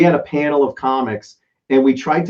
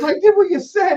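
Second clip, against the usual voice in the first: much higher mock defensive voice; Bob protesting. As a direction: insist you only followed orders. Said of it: "He goes".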